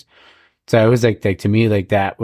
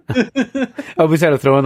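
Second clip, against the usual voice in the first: laughing; 6 semitones higher than usual. Real, not acted. Laughs at own hockey joke.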